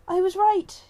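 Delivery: loud, high burst of triumph; she-cat exclaiming as she races up; excited exclamation. A narrator's reading. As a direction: blurt it out thrilled and loud. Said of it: "she exclaimed".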